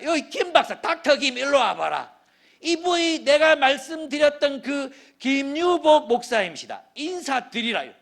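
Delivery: hammed-up proud patient commanding a doctor; bossy patient. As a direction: summon the doctor like you own the place. Patient ordering the doctor about.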